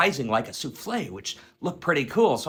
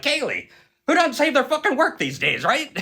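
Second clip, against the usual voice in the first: much higher, louder exasperated jab; exasperated outburst; 13 semitones higher, 5 dB louder than usual. Retold or imitated. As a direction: fling it at Kaylee in mock disbelief. Scolding Kaylee about unsaved work.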